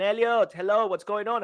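loud, high, bright hello down the phone; cheery phone greeting. Acted. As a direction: greet him like an old friend calling. Phone call acted out.

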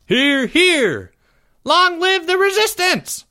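very high, loud squealing cheer; shriek. A narrator's reading. Jeffrey hailing the resistance, piercing high shriek. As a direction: shriek it like an overexcited kid. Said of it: "shrieked Jeffrey".

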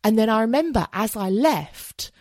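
normal talking voice

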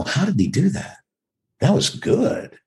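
high, wondering self-quote; admiring amazement. Retold or imitated. Actor recalling watching a commercial, admiring amazement.

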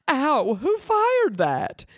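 high, slow pained "Ow"; hurt woman. Acted. Michelle shot in the arm, high and slow. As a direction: drag it out like someone just shot.

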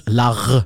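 "large" with a throaty French R; "large" in a French accent. Shown as a demonstration. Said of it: "But in French".

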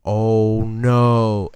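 loud groaning oh no at the TV; watching the play go wrong; dismayed oh no. Retold or imitated. Fan groaning at the TV screen, loud dismay. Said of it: "I'm just sitting there like".

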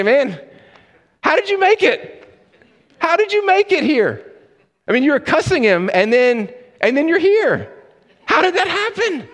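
very high-pitched, squealing disbelief; how on earth did you get in, voice shooting way up; incredulous amazement. Acted. Greeting the thief in heaven, incredulous and high-pitched.

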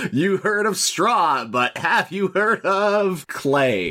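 slow, drawn-out teaser voice; mock trailer tagline. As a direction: stretch it toward a dramatic reveal.

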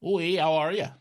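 put-on jokey Canadian accent greeting the listener. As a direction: play a cartoon friendly Canadian. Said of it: "I like doing the little accent".

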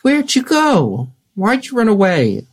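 high, stretched-out fussing; pleading reproach. Acted. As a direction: ask a runaway little friend in a high voice. Character scolds runaway friend on finding him.

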